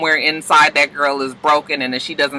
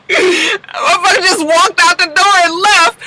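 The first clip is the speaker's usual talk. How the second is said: laughing disbelief; 13 semitones higher than usual. Real, not acted. Laughing at Bishop leaving.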